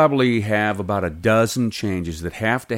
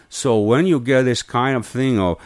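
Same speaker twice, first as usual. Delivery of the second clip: put-on Mexican accent. Shown as a demonstration. Coach explains foreigner not meeting eyes, Mexican accent. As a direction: take on a Mexican immigrant's accent.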